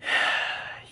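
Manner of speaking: long unvoiced sigh; weary sigh before confessing; heavy sigh. Real, not acted. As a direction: let out a tired sigh. After admitting the climb.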